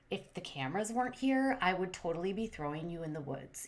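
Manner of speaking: low, hushed imitation of contained annoyance; quiet seething intensity. Vlogger voicing the annoyed suitor's look, quietly menacing. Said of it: "he had that kind of intensity".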